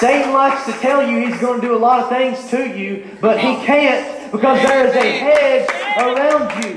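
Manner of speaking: high, loud proclamation; preacher thundering reassurance; full-force preaching. Acted. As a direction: assure them with fire. Preaching to the congregation.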